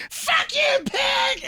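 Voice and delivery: very high screamed heckle, mocking; shrill mock battle cry; imitated protest yell. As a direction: mimic a protester screaming at police. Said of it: "She's like".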